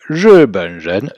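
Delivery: Jebenjen said slowly, ending on jen; Jebenjen, deliberate teacher's reading; slow model pronunciation. Shown as a demonstration. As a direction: stress the jen of Jebenjen for the class. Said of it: "Il n'y a que pour les Japonais".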